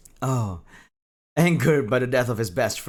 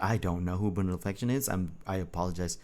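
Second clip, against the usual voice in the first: ordinary delivery, nothing marked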